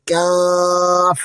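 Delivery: কাফ drawn out slowly and lightly, no force; কাফ chanted slow with no extra force; natural Qaf. Shown as a demonstration. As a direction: model কাফ gently for students to repeat. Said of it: "আমার সাথে স্বাভাবিকভাবে পড়ুন".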